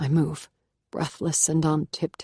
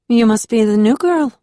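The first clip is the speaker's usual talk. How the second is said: put-on higher, louder young woman's voice, startling from the dark; female housemate voice; 7 semitones higher, 9 dB louder than usual. A narrator's reading. Housemate startles the new girl in the dark kitchen, higher and louder. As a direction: surprise her from behind with a higher, louder woman's voice. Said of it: "a female voice says from behind me".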